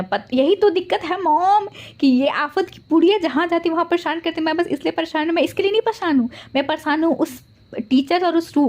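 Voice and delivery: high, teary fussing voice; crying worried dad. Acted. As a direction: fret like a weeping father. Wing crying, worried about son. (रोते हुए पिता की ऊँची आवाज़)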